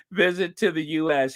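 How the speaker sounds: normal talking voice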